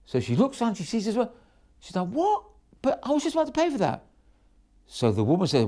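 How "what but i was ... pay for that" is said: mock indignant surprise. Fishmonger quarrel, woman protests.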